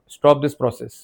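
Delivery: ordinary delivery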